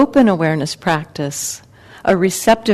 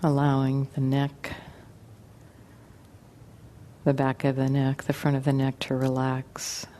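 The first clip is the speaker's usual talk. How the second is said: guided meditation; 3 semitones lower, 8 dB quieter than usual. Acted. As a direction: ease them gently into relaxation, unhurried and quiet.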